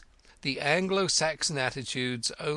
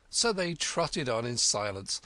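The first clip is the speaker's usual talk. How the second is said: ordinary delivery, nothing marked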